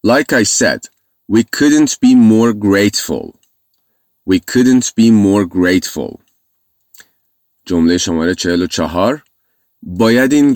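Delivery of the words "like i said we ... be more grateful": very slow repetition of the sentence; slow model reading. Shown as a demonstration. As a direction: say it once more, slowly. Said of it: "یک بار دیگه".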